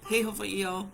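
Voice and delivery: ordinary talk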